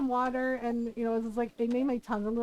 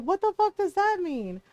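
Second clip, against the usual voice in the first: higher, punching fuck; exasperated quoted retort; incredulous sister; 7 semitones higher, 3 dB louder than usual. Retold or imitated. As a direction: sister baffled by mom's advice, higher. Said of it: "My sister's like".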